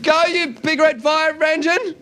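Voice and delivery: high, slow, drawn-out call; insistent high-pitched shout; acted yell. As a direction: yell it out to the crowd. Fireman repeating his yell.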